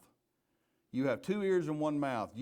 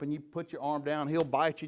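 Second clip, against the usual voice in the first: ordinary talk, nothing marked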